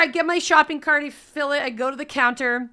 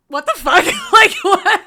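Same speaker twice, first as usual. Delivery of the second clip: loud, laughing what the fuck; stunned laughing outburst; shocked disbelief; 6 semitones higher, 10 dB louder than usual. Retold or imitated. Shocked reaction retold.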